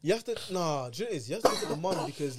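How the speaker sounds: normal talking voice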